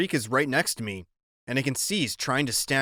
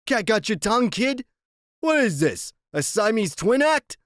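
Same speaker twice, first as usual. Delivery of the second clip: louder, higher taunting biker; 9 semitones higher, 3 dB louder than usual. A narrator's reading. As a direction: jeer at the kid, loud and pushy.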